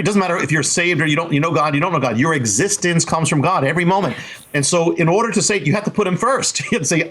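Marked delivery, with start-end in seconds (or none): none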